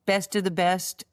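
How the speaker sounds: ordinary talk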